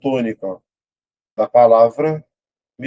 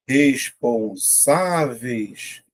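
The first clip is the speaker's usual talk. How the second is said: "Responsáveis" very slow, SÁ held long; syllable by syllable; much slower than usual. Shown as a demonstration. Teacher testing an option in the next question, syllable by syllable. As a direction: pull "Responsáveis" apart, leaning on SÁ. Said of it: "Vou separar".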